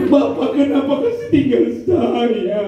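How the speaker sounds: high, drawn-out widow's wail; exaggerated crying lament; mock wailing. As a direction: wail slowly, hamming up the grief. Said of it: "paling menangis".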